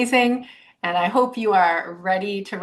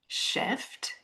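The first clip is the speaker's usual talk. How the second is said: breathed word; 10 dB quieter than usual. Real, not acted. Host stressing an energetic shift, nearly whispered. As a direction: shift barely voiced, very soft. Soft emphasis on key word.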